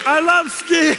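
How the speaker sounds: very high, loud giddy squeal; hammed-up gleeful squeal; giddy friend. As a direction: gush like the friend who just reappeared. Friend's delighted reply retold.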